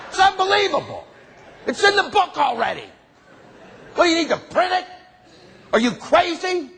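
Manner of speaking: high, exasperated disbelief; incredulous rant. Acted. Ranting disbelief at politicians.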